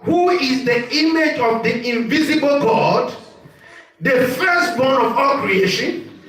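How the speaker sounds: slow, forceful preacher's reading with drawn-out words; declaimed scripture reading. Acted. Preacher reading scripture to the congregation, slow and declamatory. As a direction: proclaim each phrase with weight, stretching key words.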